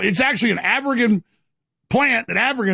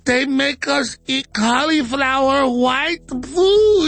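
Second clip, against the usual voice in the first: slow, rising hammed-up wail; mocking hysterical whine of a politician; 5 semitones higher, much slower than usual. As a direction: whine it higher and higher, crying. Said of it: "A O C's like".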